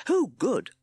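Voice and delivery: high toad voice, loudly. A narrator's reading. Timid Toad so all could hear, very loud. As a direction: belt it out for everyone to hear. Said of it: "then very loudly".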